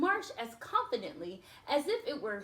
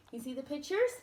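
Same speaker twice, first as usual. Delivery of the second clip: plain reading voice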